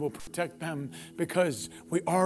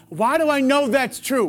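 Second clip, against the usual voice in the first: rhetorical shout; 9 semitones higher, 11 dB louder than usual. Real, not acted. Preacher's sudden rhetorical outburst.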